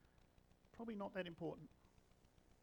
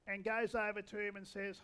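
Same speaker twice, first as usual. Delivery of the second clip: ordinary talk, nothing marked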